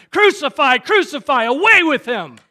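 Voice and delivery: high, very loud mob shouting; crowd crying out. Acted. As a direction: shout it as a whole baying crowd. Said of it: "Just the whole crowd's like".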